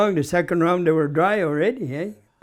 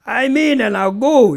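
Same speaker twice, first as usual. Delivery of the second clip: gruff superintendent talk, high and louder; gruff boss voice; 9 semitones higher, 8 dB louder than usual. Retold or imitated. Superintendent giving orders on the bridge, gruff loud voice. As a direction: put on the boss's gruff way of talking. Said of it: "that's how he talked".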